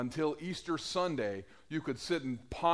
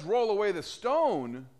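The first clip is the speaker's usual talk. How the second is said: louder, higher command; 6 semitones higher, 6 dB louder than usual. Retold or imitated. Jesus ordering the stone moved, louder. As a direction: order it with authority.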